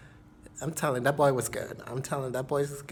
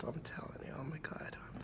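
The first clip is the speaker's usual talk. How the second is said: hushed, dreamy whisper; 19 dB quieter, faster than usual. Real, not acted. Whispering to viewers, still thinking about him. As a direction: whisper it like a secret you still savor.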